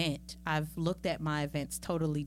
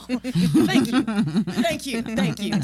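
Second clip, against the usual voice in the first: laughing; 5 semitones higher, 11 dB louder, much slower than usual. Real, not acted. Laughing thanks.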